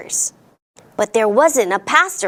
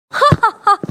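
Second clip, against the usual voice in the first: high, scoffing ha-ha-ha; hammed-up dismissive laugh; mock laughter; 9 semitones higher, 3 dB louder, faster than usual. A narrator's reading. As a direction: laugh it off theatrically. Mayor scoffs at the girl.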